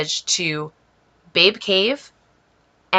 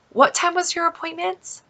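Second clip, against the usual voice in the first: imitating hairdresser; 10 semitones higher than usual. Hairdresser's question, retold by the speaker.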